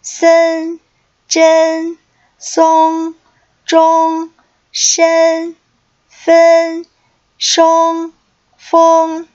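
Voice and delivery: high, loud, evenly paced drill reading; pronunciation drill. Shown as a demonstration. Teacher leading students through practice syllables, a loud measured drill call. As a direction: call each syllable out clearly for students to repeat.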